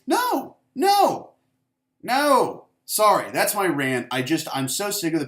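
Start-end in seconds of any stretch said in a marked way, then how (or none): 0.1-2.7 high, loud, insistent noes; emphatic no. Real, not acted. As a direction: shoot the idea down hard, pitched way up. Emphatic denial in rant.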